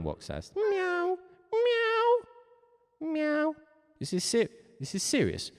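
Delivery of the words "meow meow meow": high drawn-out cat meows; squeaky-high imitation cat. Shown as a demonstration. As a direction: meow like a little cat, way up high. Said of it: "You better be meowing".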